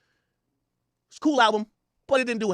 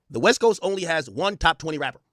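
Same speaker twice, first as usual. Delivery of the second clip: ordinary talk, nothing marked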